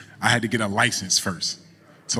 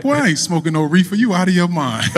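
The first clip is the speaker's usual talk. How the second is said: imitating a grandma's high, loud scoff; amused disbelief; 9 semitones higher, 7 dB louder than usual. Grandmother rebuffs grandson's reefer suggestion, amused disbelief. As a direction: scold the boy in mock outrage, highly amused. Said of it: "she started dying laughing".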